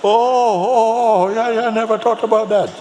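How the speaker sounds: high, hammed-up astonishment; long loud ohs, then a quick high admission; loud dawning realization. Jamaican man learns frankincense is incense, hammed-up loud surprise. Acted man's sudden realization.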